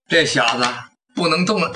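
ordinary delivery, nothing marked

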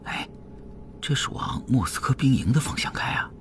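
furtive whisper in a companion's ear. A narrator's reading. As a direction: whisper it so the woman in front won't hear. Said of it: "对炳坤耳语". (凑近耳语，压低声音)

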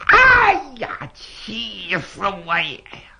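a sharp high cry, then a slow, strained groan of rage; young son raging at his mother's death; furious outcry. Acted. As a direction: explode in grief and fury. Son hears mother died.

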